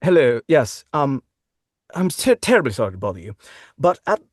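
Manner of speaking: put-on fussy British RP accent, ordering pizza by phone; polite received pronunciation caller, flustered. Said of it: "a British received pronunciation accent on the phone ordering".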